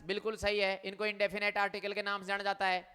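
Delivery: ordinary talk, nothing marked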